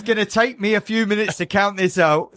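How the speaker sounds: imitating the host. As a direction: play the host shooing the couple off. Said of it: "and he goes".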